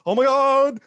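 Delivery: high, loud, drawn-out cry; hammed-up shout of shock; mock amazement. Planted helper runs down to patient, mock-amazed cry.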